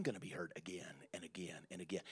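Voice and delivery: whispered softly; whispered admission. Real, not acted. Preacher admitting he too will be hurt, whispering. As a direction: let it slip out as a hush.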